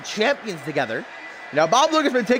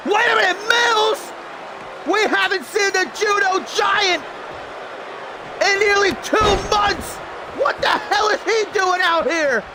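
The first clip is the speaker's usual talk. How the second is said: excited play-by-play; 7 semitones higher, slower than usual. Acted. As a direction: call the action like a ringside announcer.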